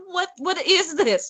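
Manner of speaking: high, disbelieving what; what is this, said in high disbelief; incredulous. Real, not acted. Incredulous reaction to the book.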